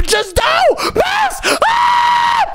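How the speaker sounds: very high, slow, stretched-out scream; panicked scream. Real, not acted. Gamer inches from the finish line, panicked scream. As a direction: scream it in rising desperation. Self-coaching at finish, last take.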